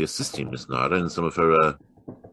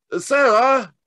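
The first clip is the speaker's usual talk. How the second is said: much higher, louder shout across the lawn; calling louder; 8 dB louder, slower than usual. Acted. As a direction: call her name again, stronger. Holmes calls again, louder.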